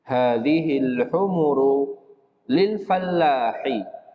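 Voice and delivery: slow, careful Arabic reading for students; slow drill reading. Shown as a demonstration. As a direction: read each sentence slowly and clearly for the class. Reads Arabic drill sentences slowly. (membaca kalimat latihan pelan-pelan)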